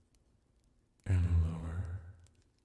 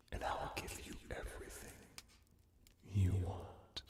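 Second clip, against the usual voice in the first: whispered hypnotic promise; hushed promise; 11 dB quieter than usual. Acted. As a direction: drop to a soft, intimate whisper. Hypnotist makes an intimate promise.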